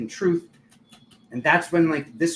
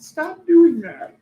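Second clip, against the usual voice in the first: much higher, louder, very slow mock yell; 12 semitones higher, 7 dB louder, much slower than usual. Guide yelling at the Capricorns, slow, high mock yell. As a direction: shout it slowly like a scolding guide. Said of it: "He yelled".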